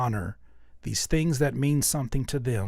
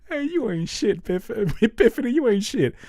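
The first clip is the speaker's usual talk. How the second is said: high, loud laughing mock insult; hammed-up mom trashing macaroni art; mock roast of a kid; 9 semitones higher, 7 dB louder than usual. Pretend mom loudly roasting her child's macaroni art, laughing. Mother mocking child's gift.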